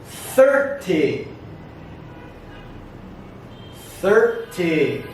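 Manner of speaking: "thirty" slowly, lifting the first syllable; stress on first syllable. Shown as a demonstration. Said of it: "The stress goes up".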